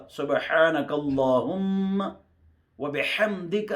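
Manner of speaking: سبحانک اللہم و بحمدک slowly, with Arabic ح and بحمدک ending in ک with no دے; correct recitation. Shown as a demonstration. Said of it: "دے کا نہیں". (و بحمدک صحیح تلفظ کے ساتھ)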